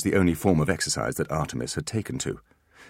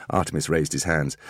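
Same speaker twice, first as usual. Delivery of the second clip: plain reading voice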